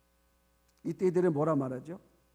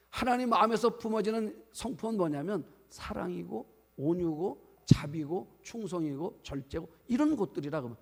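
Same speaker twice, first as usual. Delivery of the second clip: normal talking voice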